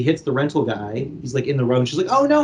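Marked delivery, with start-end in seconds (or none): 2.1-2.4 alarmed oh no. Retold or imitated. She is about to hit guy.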